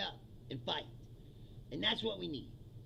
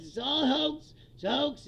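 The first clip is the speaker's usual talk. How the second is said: louder, raised parody of a denier; parroting a politician's brush-off; mock denial; 4 semitones higher, 6 dB louder than usual. Mimicking Trump hiding something real, mock protest. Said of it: "first thing he says".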